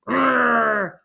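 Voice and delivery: long rolled "grrr"; fish's growl. A narrator's reading. Big fish answering the father's bargain, growling. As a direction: growl like a thwarted storybook fish. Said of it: "said the big fish".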